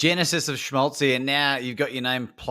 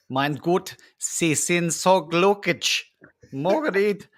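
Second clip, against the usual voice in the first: playful put-on German accent; joking bursts of German, spaced out; 3 semitones higher, slower than usual. Host shows off for a laughing guest, in a German accent. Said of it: "Don't make me do a German one".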